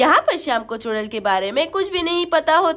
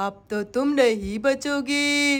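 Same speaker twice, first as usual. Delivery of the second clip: low, soft, slow threat; menacing witch; 3 semitones lower, 5 dB quieter, slower than usual. Acted. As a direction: draw it out like a gloating witch. Witch threatens caught child. (चुड़ैल की धीमी, भारी धमकी)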